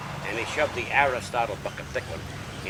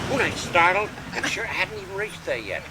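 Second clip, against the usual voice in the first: usual speaking voice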